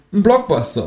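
high, cheeky kangaroo. A narrator's reading. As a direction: pitch way up for the kangaroo. Kangaroo character voice.

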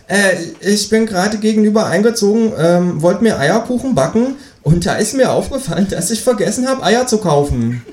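a cheeky kangaroo asking for eggs. Acted. Kangaroo voiced in read story. (das Känguru bittet um Eier, mit hoher Stimme)

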